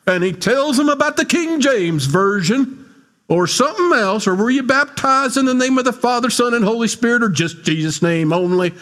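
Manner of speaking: high, sustained affected preacher voice; hammed-up mock preacher, pitched well up; affected preacher talk. Pastor mocks a King James zealot questioning new believers, affected preacher talk. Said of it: "some guy that talks like this".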